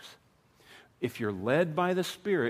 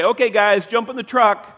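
loud, raised call to the flock; 7 semitones higher, 11 dB louder than usual. Acted. Farmer to newly bought sheep, calling out. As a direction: holler it out like herding animals.

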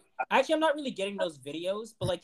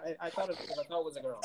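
normal talking voice

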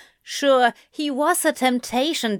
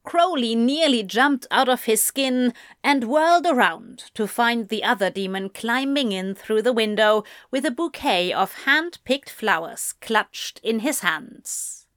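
ordinary delivery

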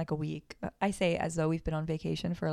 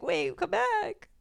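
panicked plea; 13 semitones higher, slower than usual. Retold or imitated. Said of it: "And I was like".

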